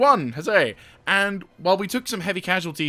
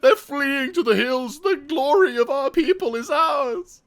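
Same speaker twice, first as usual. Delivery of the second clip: victorious commander; 12 semitones higher, 5 dB louder than usual. Pretend. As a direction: declare victory to the troops, grandly.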